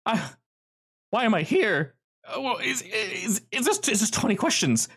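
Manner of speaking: high, startled man's voice; a jittery prisoner caught off guard; nervous trapped man. Acted. GM voices Rick.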